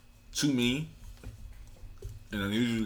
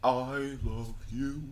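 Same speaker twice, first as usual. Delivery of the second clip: slow, higher dinosaur voice; sing-song kids' dinosaur voice; 6 semitones higher, much slower than usual. Pretend. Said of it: "Except in a dinosaur voice".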